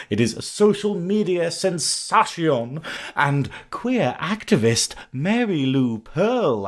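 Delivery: slow, drawn-out announcer flourish with big pitch swoops; grand guest introduction. Real, not acted. Host welcomes the guest onto the podcast, grand announcement. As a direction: roll out the guest's name slowly and grandly.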